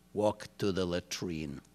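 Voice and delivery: hushed and low, sly order; whispered. A narrator's reading. Guard slyly murmurs an order to a prisoner, hushed. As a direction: murmur it softly, a sly command. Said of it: "and he whispered".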